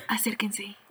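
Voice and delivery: soft, voiceless "come closer"; imitated whisper. The stranger calling the couple closer, whispered. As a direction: beckon them over in a breathy whisper. Stranger beckoning them secretly.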